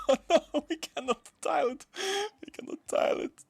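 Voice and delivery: high-pitched wail breaking into laughter; laughing dismay. Real, not acted. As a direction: wail helplessly through real laughter. Laughing dismay at failed plan.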